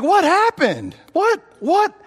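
high, baffled double-take; stunned what happened; bewildered. Retold or imitated. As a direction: ask it in disbelief, voice jumping up. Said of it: "And it's like".

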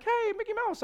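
very high cartoon squeak; Mickey Mouse. Preacher mocking a cartoonish angel greeting, squeaky and high. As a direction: greet in a silly falsetto mouse voice. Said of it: "I don't think that's how the angel".

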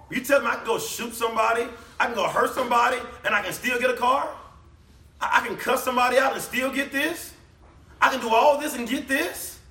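hammed-up greedy believer; worldly churchgoer voiced. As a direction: play someone thrilled they can sin and still get paid. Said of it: "What were they gonna say?".

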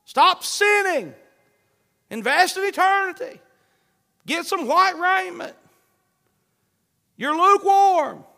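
high, loud, slow thundering call; voice of the Lord calling. Acted. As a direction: shout it down like God waking the sleeper. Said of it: "If the Lord is saying".

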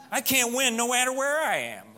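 exasperated. Real, not acted. Exasperated joke about accent.

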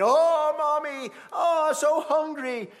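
put-on high, loud whimpering child, drawn out; imitating a crying child; whiny child. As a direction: whine like a hungry little kid. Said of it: "Your children comes to you crying".

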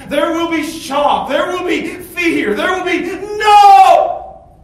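climbing slow shout that peaks on "no"; preaching shout. Acted. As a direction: build it to a desperate, slow cry. Preacher crying out about hell.

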